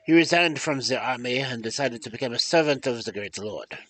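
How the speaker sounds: storytelling in a put-on French accent; French-accented storyteller, meant to be German. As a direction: read the tale aloud with a makeshift French accent. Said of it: "Why am I doing a French accent".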